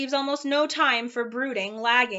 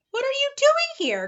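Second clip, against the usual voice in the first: much higher, louder angry demand; growled; 6 dB louder than usual. Acted. Watchdog confronting Milo, angry and louder. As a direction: challenge him like a cross guard dog. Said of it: "growled the watchdog".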